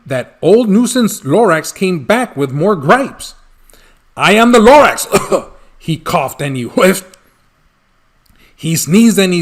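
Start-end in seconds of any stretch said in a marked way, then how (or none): 4.1-5.1 booming announcement. Acted. Lorax announces himself again.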